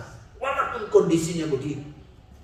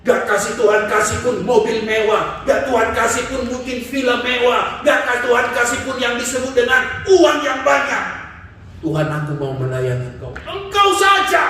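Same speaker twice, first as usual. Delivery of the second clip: higher and louder, ending in a shout; fervent preaching climax; 9 semitones higher, 4 dB louder than usual. Acted. Preacher urging pure devotion, rising to a shout. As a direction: climb to a shouted vow.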